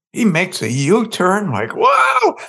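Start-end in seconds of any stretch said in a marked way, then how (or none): 1.8-2.4 high, loud cry of alarm; mock alarm as the car whips round; startled whoa. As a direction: yelp as the car swerves. Sudden U-turn in car.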